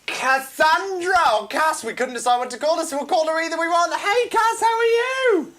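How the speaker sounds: high announcer build to a big greeting; excited guest introduction. Real, not acted. Host introduces guest with fanfare.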